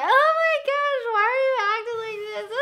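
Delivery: high, laughing oh my gosh; laughing disbelief. Retold or imitated. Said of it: "He was, like, laughing at me".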